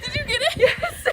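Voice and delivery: high and loud through laughter; laughing excitedly. Real, not acted. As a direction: giggle out the words, thrilled. Thrilled after the lick.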